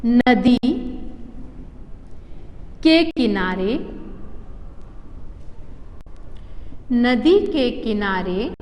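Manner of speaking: each chunk drawn out slowly, long gaps between; slow dictation. Shown as a demonstration. Teacher dictates an example sentence to the class, slowly. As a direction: read it word by word so pupils can write. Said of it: "ऐसे ही हम देख लेते हैं".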